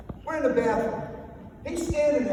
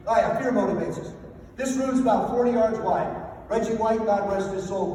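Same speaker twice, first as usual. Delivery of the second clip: normal talking voice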